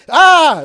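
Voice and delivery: high, loud mock scream; hammed-up fright scream; retold yelp of panic.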